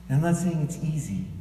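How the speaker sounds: ordinary talk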